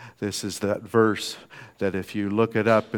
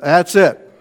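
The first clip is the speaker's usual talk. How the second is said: higher, louder exasperated snap; fed up; 7 semitones higher, 12 dB louder than usual. Retold or imitated. Preacher voicing God giving up on people, mock exasperated. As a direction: slam the door on it.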